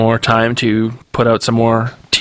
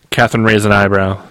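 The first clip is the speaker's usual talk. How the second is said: plain reading voice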